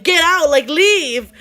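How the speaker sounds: high, loud order to get out; forceful re-enacted shout; retold yelling. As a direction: imitate a mother yelling someone away. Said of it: "my mom's yelling at him".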